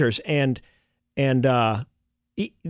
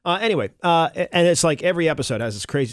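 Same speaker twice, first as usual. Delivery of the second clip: normal talking voice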